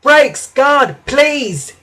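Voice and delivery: high, loud call to the guard; urgent call. A narrator's reading. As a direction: call out sharply for help braking. Engine urgently calls for brakes.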